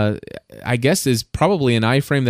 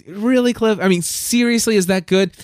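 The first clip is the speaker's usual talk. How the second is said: skeptical friend; 8 semitones higher than usual. Retold or imitated. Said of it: "and he's like".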